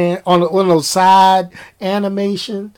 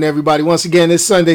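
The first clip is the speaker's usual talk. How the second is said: ordinary talk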